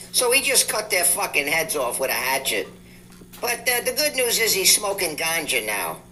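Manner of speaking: put-on rough Jamaican accent, rising pitch; a shaky try at Jamaican patois. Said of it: "you gotta excuse my Jamaican accent".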